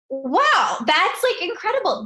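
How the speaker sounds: rising high with amazement, each word climbing; a mom gasping in wonder at her son; amazed. Retold or imitated. Mom telling her son how amazed she was, pitch climbing high.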